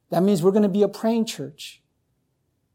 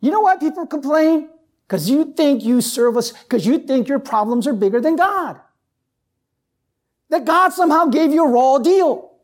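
preaching at full force; 7 semitones higher, 3 dB louder than usual. Acted.

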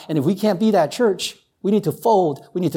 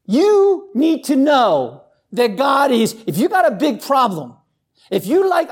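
rising preacher's cry; preaching at full force; 8 semitones higher than usual. Acted. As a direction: lift it and drive it home to the congregation.

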